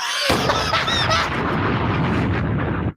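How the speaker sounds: long breathless high laugh; high wheezing laughing fit; cracking up. Real, not acted. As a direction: burst into helpless laughter. Cracks up at the reply.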